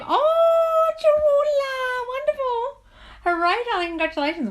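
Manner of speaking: very high, loud squeal easing down; shrieked, drawn-out celebration; real excited cheer. Real, not acted. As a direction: whoop with delight for them. Celebrating viewer's partner coming back.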